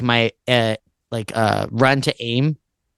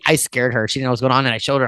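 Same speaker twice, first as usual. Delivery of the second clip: usual speaking voice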